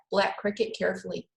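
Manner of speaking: ordinary delivery, nothing marked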